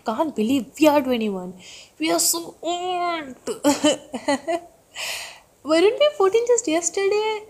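high-pitched, fast squealing outburst; excited disbelief. Real, not acted. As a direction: gush as if you can't believe it.

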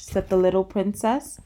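usual speaking voice

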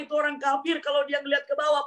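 high shouted warning; urgent cry. Acted. Abu Bakar crying out about the enemies, high shout. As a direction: call out the danger.

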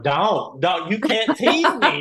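high, pleading whine with a laugh; mock pleading. To the researcher, a high laughing plea. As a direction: beg her not to tease, half laughing.

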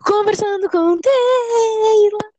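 slow, drawn-out show intro; announcer voice. Acted. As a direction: announce the show title grandly.

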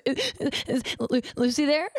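imitating a stuttering, sobbing phone call; mock sobbing. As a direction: stammer it out like a hammed-up crier. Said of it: "it was sobbing".

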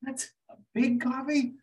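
high, puzzled put-on voice; mock Italian disbelief at a big coffee; baffled Italian barista. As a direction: play the confused Italian barista. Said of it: "these Italians are like".

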